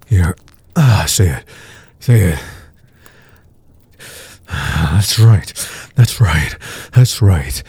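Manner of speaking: normal talking voice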